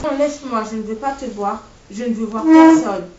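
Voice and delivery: rising to a loud despairing yell; angry refusal. A narrator's reading. Injured teen sending everyone away, rising to a loud despairing yell.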